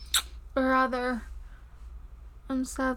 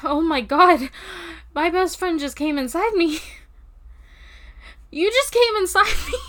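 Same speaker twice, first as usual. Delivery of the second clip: louder giddy disbelief, faster at the end; excited disbelief; 5 semitones higher, 8 dB louder than usual. Acted. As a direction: gasp it out in giddy shock.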